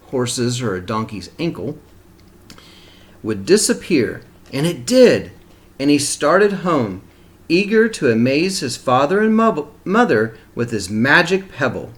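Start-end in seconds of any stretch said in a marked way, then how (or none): none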